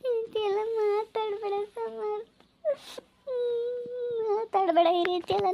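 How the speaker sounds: soft, high, tearful begging; weepy wife begging him to stop; crying plea. Acted. Wife begging husband on phone.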